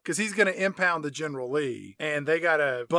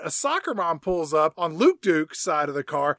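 ordinary talk, nothing marked

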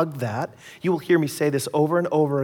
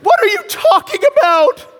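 much higher, louder mock wife voice; whiny wife; 9 dB louder than usual. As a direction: ham up the wife's complaint. Wife answering, annoyed.

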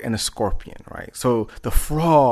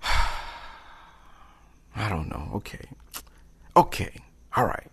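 soft, hesitant giving in; reluctant agreement; 2 semitones lower, 5 dB quieter, much slower than usual. Acted. Frog agrees to carry scorpion, hesitant and reluctant. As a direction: give in softly, with long pauses.

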